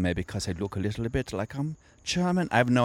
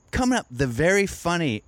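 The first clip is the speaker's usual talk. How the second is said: mock host intro; 8 semitones higher than usual. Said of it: "When he's like".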